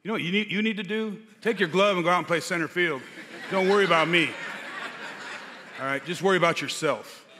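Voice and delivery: hammed-up indignant snap back; mock angry comeback. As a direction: tell him off, retelling it with punch. Retells angry comeback to gentle teammate.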